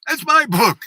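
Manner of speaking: high, loud startled accusation; high-pitched mock outburst of a young woman; girl's accusing cry. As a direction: demand it back as if it's yours. Girl confronts him over book.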